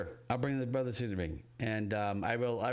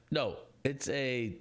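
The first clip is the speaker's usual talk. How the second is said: usual speaking voice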